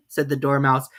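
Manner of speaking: usual speaking voice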